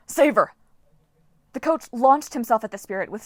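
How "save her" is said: loud, high order; urgent yell. Acted. Coach Hedge yelling at Jason to save Piper. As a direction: shout it fast. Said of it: "Hedge yelled".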